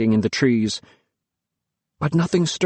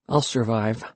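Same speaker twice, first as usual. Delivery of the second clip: muttered, holding back; 2 semitones lower, 5 dB quieter than usual. Acted. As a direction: keep the tears out of it. Boy holding back tears.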